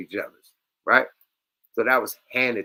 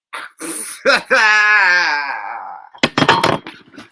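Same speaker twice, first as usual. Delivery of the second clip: loud, high screaming laughter; shrieking laugh; 6 semitones higher, 8 dB louder than usual. Real, not acted. Cracking up at the clip, shrieking laughter. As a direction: howl with laughter.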